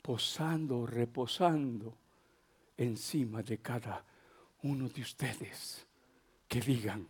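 very low, barely voiced murmur; hushed, moved. Real, not acted. Preacher recalling last night's tearful thanks, hushed. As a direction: sink into near-silent, halting reverence. Recalls weeping prayer, nearly whispering.

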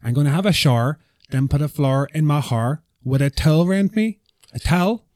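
put-on Belfast lilt, rising at the end; Belfast accent. Shown as a demonstration. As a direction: recite it as a Belfast tune-in phrase. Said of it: "help me tune into the Belfast".

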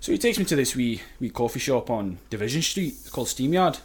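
put-on chatty anecdote in a convincing Scottish accent. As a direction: tell it casually as a Scot. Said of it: "I'm not even Scottish".

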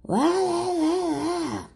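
high, wailing fake crying; mocking Avery sobbing, very high and wailing. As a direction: blubber nonsense like a crying child. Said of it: "And Avery's just like".

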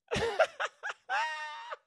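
cackling shout of laughter. Real, not acted. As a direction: crack up at your own joke. Laughing at own joke.

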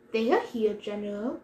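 usual speaking voice

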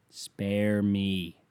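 normal talking voice

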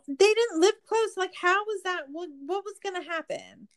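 high-pitched incredulous questioning; exasperated disbelief. Real, not acted. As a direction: pitch up in disbelief at how dumb the plan was.

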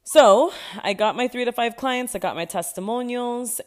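proud triumph. Real, not acted. Triumphant report.

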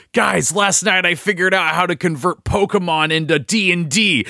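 high, eager nerd voice; nerdy know-it-all announcing a discovery. Retold or imitated. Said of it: "in the stereotypical nerd voice".

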